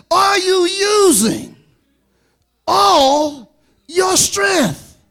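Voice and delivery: slow, drawn-out father's question; father repeating slowly. Retold or imitated. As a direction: stretch every word like a father pressing the point. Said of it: "But he said".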